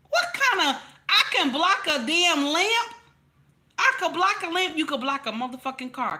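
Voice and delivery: high, loud, disbelieving retort; incredulous outrage. Acted. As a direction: throw the absurd advice back, voice shooting up. Mocking advice to block blows.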